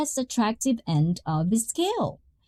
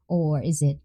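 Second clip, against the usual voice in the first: ordinary delivery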